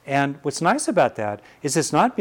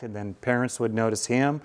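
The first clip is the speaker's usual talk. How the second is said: normal talking voice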